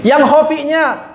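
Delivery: ordinary delivery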